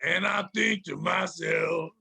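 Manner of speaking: slow [name] parody; [name] impression. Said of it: "in [name]'s voice".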